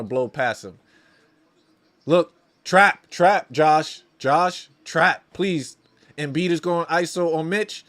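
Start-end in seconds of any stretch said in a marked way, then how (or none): 2.1-5.8 loud, pleading shouts to a player; exasperated fan yelling instructions; urgent call-out. Real, not acted. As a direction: bark short orders from the sidelines. Calling out to player on screen.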